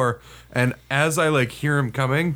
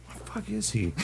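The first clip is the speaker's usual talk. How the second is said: very soft, higher-pitched puzzled mutter; imitated muttering to himself; 6 semitones higher, 11 dB quieter than usual. Sergeant smoking outside the dumpster, quiet puzzled self-talk. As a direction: wonder under your breath, barely aloud. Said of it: "I hear him thinking out loud to himself".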